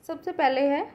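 ordinary delivery

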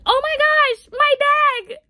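high, very loud re-enacted cry of alarm; panicked shout. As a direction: yell it out as if watching disaster tumble away. Reliving panic as bag falls.

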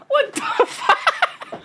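high, slow wheeze of laughter; laughing disbelief. Real, not acted.

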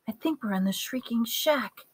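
hushed and high, very soft; whispered. A narrator's reading. Hermione telling Harry where they are, whispered. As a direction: breathe it as a secret whisper. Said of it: "she whispered".

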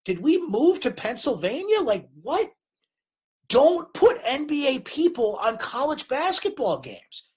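exasperated, high swooping pitch; frustrated rant. Real, not acted. Host venting about NBA people on college games, frustrated. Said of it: "that is so frustrating".

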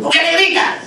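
high-pitched protective outburst; indignant mother. Acted. Child's mother to the man scolding her, indignant high-pitched protest. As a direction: leap in with a high, indignant challenge. Acts mother objecting instinctively.

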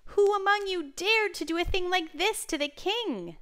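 high, loud, insistent demand; angry roar. A narrator's reading. King repeating his demand to silent insects, angry roar. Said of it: "Solomon repeated".

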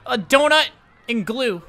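high, loud eager listing; excited character voice. Acted. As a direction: rattle off names loud and high. Voiced character lists pets.

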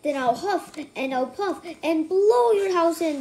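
a wolf's loud threat; wolf yelling. Acted. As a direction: yell it out to blow the house down. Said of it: "the wolf yelled".

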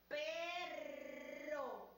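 "perro" drawn out, long trilled rr; slowly. Shown as a demonstration. Teacher slows "perro" so children can hear the rr. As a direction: stretch "perro" syllable by syllable. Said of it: "La decimos más despacio".

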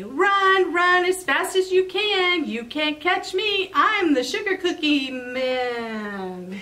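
high, sing-song cookie taunt. Acted. Cookie taunting Fox to chase him, high sing-song voice. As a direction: tease the fox with a high chant.

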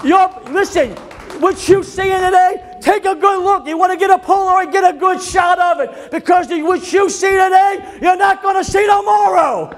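loud, high shouted preaching; full-force preaching. Real, not acted. Preacher rallies the congregation, shouting high and loud. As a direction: preach it at the top of your voice.

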